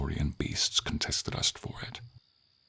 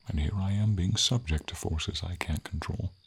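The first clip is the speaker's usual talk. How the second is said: usual speaking voice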